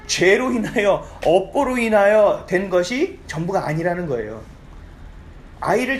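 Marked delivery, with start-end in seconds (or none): none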